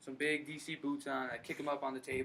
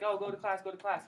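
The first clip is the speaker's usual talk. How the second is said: higher, louder imitation of mom; nagging mom; 6 semitones higher, 4 dB louder than usual. Mom sending her son off to college, nagging. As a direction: nag like a mother, pitched up. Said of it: "my mom was like".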